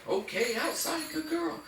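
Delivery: very high, excited puppy praise; emphasized dog cue. Shown as a demonstration. Trainer cueing dog to go out, high emphatic praise. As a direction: pitch "outside" high, then squeal the praise. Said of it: "I'm gonna emphasize the word outside".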